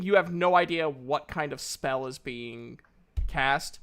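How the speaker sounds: usual speaking voice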